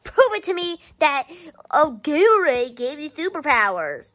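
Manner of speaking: usual speaking voice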